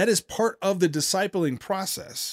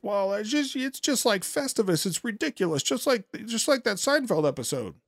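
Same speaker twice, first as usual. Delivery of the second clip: high, softened mimicking voice; mocking dismissal; 5 semitones higher, 4 dB quieter than usual. Host mimicking the preacher waving off disagreement, mocking. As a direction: parrot a dismissive reply, pitched up.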